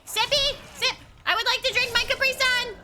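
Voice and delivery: high, loud and urgent; frantic plea. Real, not acted. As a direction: shout desperately for a chance to drink. Frantic plea to heal mid-fight.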